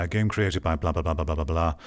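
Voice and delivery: normal talking voice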